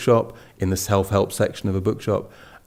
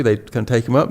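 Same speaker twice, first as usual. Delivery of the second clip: ordinary talk, nothing marked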